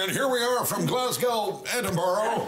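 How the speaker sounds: high, drawn-out American presenter voice; mock American on-air delivery, slow and high. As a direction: announce it like an American TV reporter. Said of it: "they got all these American presenters going".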